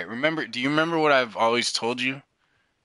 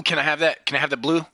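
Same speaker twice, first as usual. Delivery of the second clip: usual speaking voice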